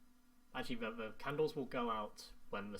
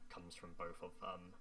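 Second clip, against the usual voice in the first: normal talking voice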